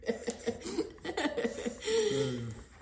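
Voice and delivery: a high, bright burst of laughter. Real, not acted. Mother laughing along at her son's reply, high laugh. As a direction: laugh up high and let it fall.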